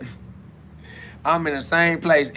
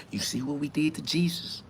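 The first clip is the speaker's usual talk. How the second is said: softer hushed warning, still voiced; quiet threat; 9 dB quieter than usual. Church elder warns the preacher about Jesus, hushed.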